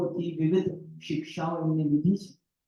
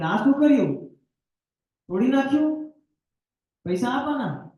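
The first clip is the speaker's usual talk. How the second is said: higher, louder angry scolding; scolding shopkeeper; 7 semitones higher, 4 dB louder than usual. Acted. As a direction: scold the child for breaking the toy. Shopkeeper scolds boy for breaking toy. (ગુસ્સે થઈને ઠપકો)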